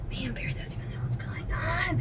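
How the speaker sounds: hushed, breath-only retold thought; very soft mock-confidential whisper; whispered aside.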